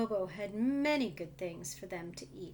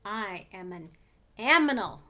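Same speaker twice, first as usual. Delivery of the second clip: the funny thing insisting, with a loud burst on aminal; insistent creature correcting Bobo; silly aminal voice; 2 semitones lower, 7 dB louder than usual. Acted. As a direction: proudly declare aminal, punching the last word. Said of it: "said the funny thing".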